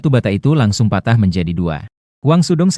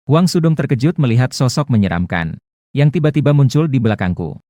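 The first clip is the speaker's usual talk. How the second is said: plain reading voice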